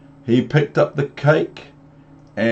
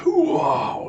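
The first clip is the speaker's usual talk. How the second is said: whispered wow. Real, not acted. Reading mess, awed aside.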